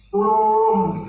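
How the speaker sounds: high, loud drawn-out yell; acted cry for help. Drowning Kiai Nasri yelling to his master, loud cry for help. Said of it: "Kiai Nasri teriak".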